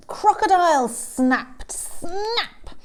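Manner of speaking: loud, high, sudden storytelling burst; dramatic snapping. A narrator's reading. Storyteller springs Crocodile on listeners, dramatic snap. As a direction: pounce on the words, ending high.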